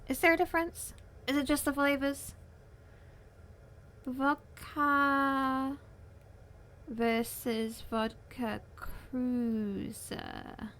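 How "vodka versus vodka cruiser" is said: drawn-out, slow search read. Real, not acted. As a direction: spell it out slowly. Reading search query slowly.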